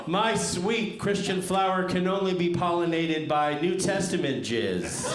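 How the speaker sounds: high, loud, booming biblical rant; fire-and-brimstone preacher. Retold or imitated. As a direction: thunder like a mad zealot. Mock biblical zealot reading profile.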